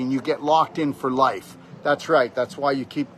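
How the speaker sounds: ordinary talk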